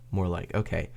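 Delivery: ordinary talk, nothing marked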